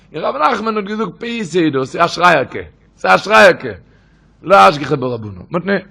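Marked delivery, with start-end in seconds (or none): 1.8-5.5 retold shout. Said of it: "hat sie geschrien".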